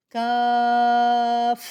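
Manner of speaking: قاف loud and full, drawn long; heavy qaaf. Shown as a demonstration. Teacher repeating the two-dot قاف. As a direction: fill the mouth and stretch قاف. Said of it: "دو نقطوں والا کاف ہے".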